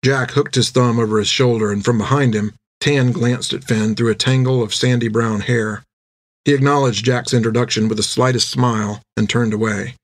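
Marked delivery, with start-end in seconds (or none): none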